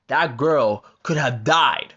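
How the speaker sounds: ordinary talk, nothing marked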